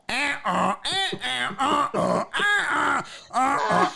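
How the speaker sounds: high nasal-free honking noises in rhythm; imitated car honks. Cars honking behind aunt, rhythmic imitated honks. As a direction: honk like a line of impatient cars. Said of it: "The honks, it became a song".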